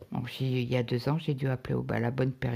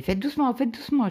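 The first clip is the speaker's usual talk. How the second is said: anxious doctor; 9 semitones higher, 5 dB louder than usual. Retold or imitated. Anxious doctor urging caution.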